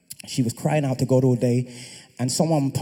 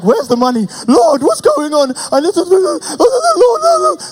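rising, louder, frantic cries to the Lord; panicked pleading; 11 dB louder than usual. Retold or imitated. Father who lost the money calls on the Lord in rising panic. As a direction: climb higher and higher in panic.

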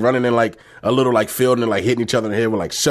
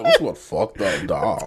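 slow, lower hipster voice; hipster guy; 4 semitones lower, much slower than usual. Retold or imitated. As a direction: drawl it out like a dazed passer-by. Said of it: "He's like".

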